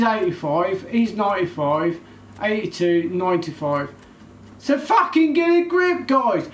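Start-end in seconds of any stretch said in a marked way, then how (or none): 4.6-6.5 high, loud outburst at the fans and EA; real furious shout; angry shout. Real, not acted. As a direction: yell it, fed up beyond words. Ranting at EA over FIFA.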